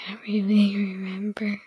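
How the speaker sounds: whispering. Real, not acted. Shy girl answering her mother.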